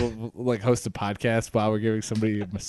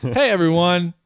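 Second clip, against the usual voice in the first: upbeat host greeting; 6 semitones higher, 5 dB louder than usual. Pretend. Pretend podcast opener.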